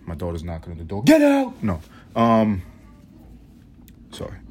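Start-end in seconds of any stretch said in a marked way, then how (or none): 1.0-1.6 sudden loud high yell. Real, not acted. Father yelling at his knocking daughter, loud and high. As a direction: shout at the door to get out.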